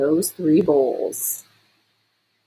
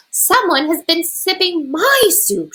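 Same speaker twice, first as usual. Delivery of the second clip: growling mama bear; 11 semitones higher than usual. A narrator's reading. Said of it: "she growled".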